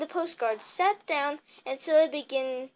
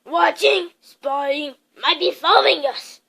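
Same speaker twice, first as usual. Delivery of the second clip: muttered wildly; 8 dB louder than usual. A narrator's reading. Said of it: "muttered Uncle Vernon wildly".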